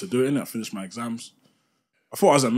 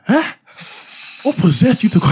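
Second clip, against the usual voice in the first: high loud shocked mum voice; much higher, louder mock mum; mum shouting; 13 semitones higher, 10 dB louder than usual. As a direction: imitate mum yelling in disbelief. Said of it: "She's going".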